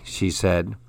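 plain reading voice

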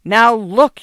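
sharp, loud protest at being ignored; a burst of anger, voice up and loud; angry. A narrator's reading. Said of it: "angry at having his work ignored".

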